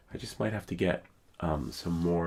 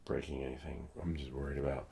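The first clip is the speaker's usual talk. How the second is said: ordinary talk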